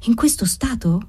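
wavering, unsure query; uncertain. A narrator's reading. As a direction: ask it hesitantly, with worry. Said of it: "chiese ella incerta".

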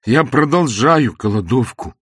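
loud, high, slowing proclamation; wretched husband's cry. A narrator's reading. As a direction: declare it as a loud protest. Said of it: "закричал несчастный муж". (несчастный муж, громкий выкрик)

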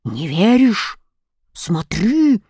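gruff bear's curt challenge; acted bear growl; barking bear. A narrator's reading. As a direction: bark it like a bear. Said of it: "рявкнул медведь".

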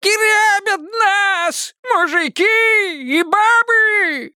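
put-on very high slow shrieking old man; piercing shriek. A narrator's reading. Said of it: "пронзительно заверещал дед Лучок".

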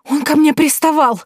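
high, loud indignant complaint; indignant outburst. Acted. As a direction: blurt out the outrage. Indignant girl.

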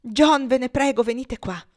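high, loud, pressing plea; wife urgently summoning her husband; urgent calling. A narrator's reading. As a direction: call out insistently for help. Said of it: "in modo vivo e incalzante lo chiamava".

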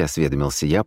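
ordinary delivery

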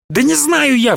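high exasperated cry with stress on не; sudden outburst. A narrator's reading. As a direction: burst out, hitting не hard. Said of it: "вдруг воскликнул Прыгунов".

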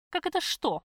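furious high shout; berserk rage. A narrator's reading. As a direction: scream it in a sudden rage. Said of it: "в ярости закричала я".

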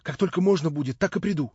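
imploring promise to return; pleading. A narrator's reading. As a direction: beg not to be followed. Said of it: "с мольбой, обратившись ко мне, сказала".

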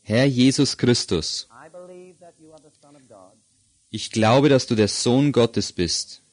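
slow prayer in short phrases, long pauses; leading a prayer. Acted. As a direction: pray line by line, leaving room to repeat. Leads a repeat-after-me prayer.

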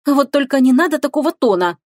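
high-pitched indignant objection; mildly affronted retort. A narrator's reading. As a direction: protest the tone, voice pushed up. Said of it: "возмутилась Теруми".

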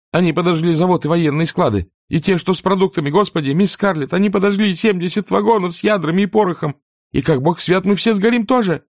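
high, frantic, near tears; panicked servant girl. Acted. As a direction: babble in terror, voice climbing. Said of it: "снова начала прерывисто всхлипывать". (перепуганная служанка, почти в слезах)

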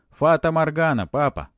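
loud, high, resolute answer; decisive. A narrator's reading. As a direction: declare it boldly. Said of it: "решительно ответил Матвей".

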